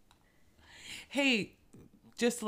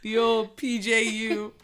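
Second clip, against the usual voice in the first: mock pride; 6 semitones higher, 10 dB louder, slower than usual. Mock proud alma mater.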